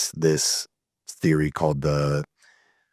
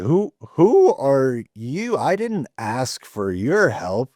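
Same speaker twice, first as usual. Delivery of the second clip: high, slow, affronted pushback; offended stranger-rebuff, drawn out; indignant "who are you"; 7 semitones higher, much slower than usual. Retold or imitated. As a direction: bristle at unwanted advice. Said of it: "You're like".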